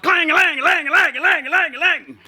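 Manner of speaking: high sung clang clang; clanging pots. Acted. Women bashing pans in the dark, high ringing clang. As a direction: ring it out like pots bashed together.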